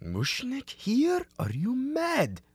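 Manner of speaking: high, slow, shocked; shocked disbelief. Acted. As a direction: gasp it out in disbelief. Shocked to see Mushnik.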